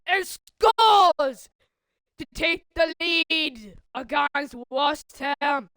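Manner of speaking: football commentary building to a high, loud, drawn-out shout; elated, stretched-out goal celebration; goal call. Real, not acted. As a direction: build with the attack, then roar the scorer's name.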